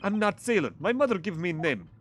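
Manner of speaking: put-on lightly accented gruff Russian sailor, proud; a hint of a Russian seaman insisting on his rank. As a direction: announce yourself like a stiff Russian guard. Player voices Russian sailor Stroganoff.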